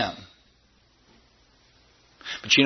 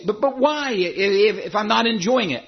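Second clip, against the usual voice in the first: mock protest, higher-pitched and faltering on the first words; stammering protest; 7 semitones higher than usual. Doubting churchgoer objects to the preacher, stammering protest.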